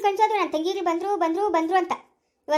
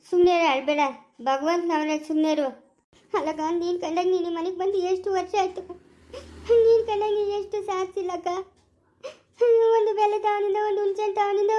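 ordinary talk, nothing marked

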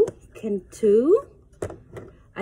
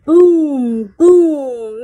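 loud booming; thunder boom; 9 dB louder than usual. Pretend. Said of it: "Mister Brown is a wonder".

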